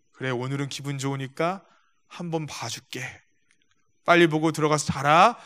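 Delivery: put-on a drunk father being gracious; gentle indulgent father. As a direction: let him off kindly, hushed. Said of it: "우아하고 인자하게 들어간 겁니다".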